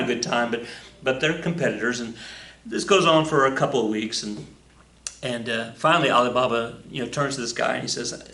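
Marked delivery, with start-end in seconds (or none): none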